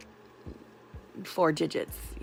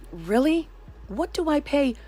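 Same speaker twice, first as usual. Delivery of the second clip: higher, incredulous pushback; annoyed; 9 semitones higher, slower than usual. Retold or imitated. Annoyed customer questioning triple A about the yearly fee, incredulous. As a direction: challenge them in annoyed disbelief. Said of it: "I wasn't laughing when I was talking to her".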